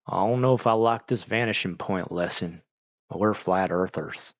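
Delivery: mock country-accented parent repeating a complaint. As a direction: put on a mild country accent. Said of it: "I always do the country accent".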